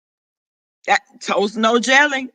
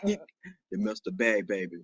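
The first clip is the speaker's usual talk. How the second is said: very low, much softer deep register; 14 semitones lower, 13 dB quieter than usual. Acted. Announcing to viewers the mister is back, quiet deep voice. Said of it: "when this boys leave me".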